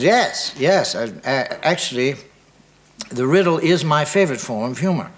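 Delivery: a keen Englishman, lightly hammed-up English accent. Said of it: "I don't do a good English accent".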